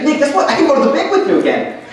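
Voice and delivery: put-on high, quick girlish announcement; excited girl. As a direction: mimic a girl bubbling with good news. Girl brings good news, retold.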